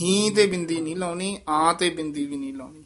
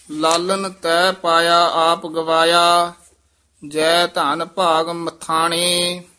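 slow measured chant of scripture; scripture recitation; 6 dB louder, slower than usual. Acted. Teacher recites Gurbani for students, in a measured chant. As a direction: recite each line with devotion.

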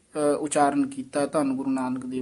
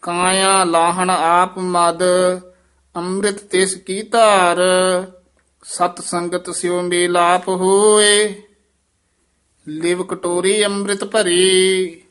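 measured chanting recitation of Gurbani; scripture recitation; 4 dB louder, slower than usual. Shown as a demonstration. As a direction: recite each phrase slowly and evenly.

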